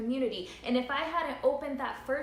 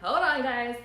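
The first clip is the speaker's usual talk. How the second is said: laughing to hold back tears; emotional; 2 semitones higher, 5 dB louder than usual. Real, not acted. Telling viewers to hold on as she gets emotional, laughing. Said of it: "look, I'm getting emotional".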